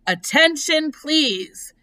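high, firm public announcement; loud, firm voice. A narrator's reading. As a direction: call for everyone's attention. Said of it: "he said in a loud, firm voice".

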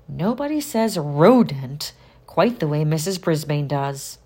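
usual speaking voice

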